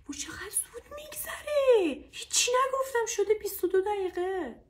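high, loud astonished outcry; high-pitched, loud surprise; real dismay at time. Real, not acted. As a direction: exclaim loudly in a high pitch at how time has flown. Notices how fast time went.

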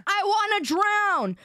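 slow, high-pitched insistence; mock exasperated. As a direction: repeat it emphatically, drawn out. Repeats herself insistently, mock exasperated.

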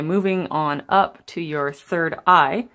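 usual speaking voice

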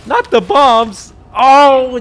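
slow, high, loud cry of dismay; panicked cry. Real, not acted. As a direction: wail it in real panic. Panic at bombs in game.

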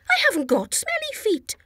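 high, huffy denial; offended protest. Acted. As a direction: sound a little insulted. Chickpea protests in story.

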